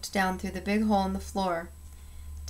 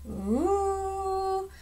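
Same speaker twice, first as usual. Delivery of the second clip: high siren wail; 11 semitones higher, 4 dB quieter than usual. A narrator's reading. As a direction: imitate a fire siren. Siren imitated after clangs.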